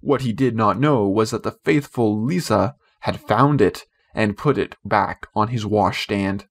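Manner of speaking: ordinary delivery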